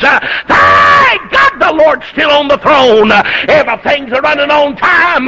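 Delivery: very high, loud preacher's cry; preacher crying out the climax; full-force preaching. Real, not acted. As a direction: shout it to the rafters.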